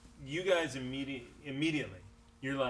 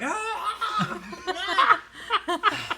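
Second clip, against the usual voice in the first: caught goblin; 6 dB louder, slower than usual. Acted.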